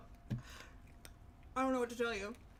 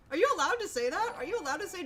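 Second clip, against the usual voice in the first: high, loud and fast, scandalised; a jolt of scandalised surprise; shocked question; 7 semitones higher, 6 dB louder, faster than usual. Real, not acted. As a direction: gasp it out in mock outrage. Shocked at chat's phrase.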